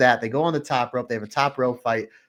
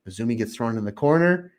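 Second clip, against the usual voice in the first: ordinary talk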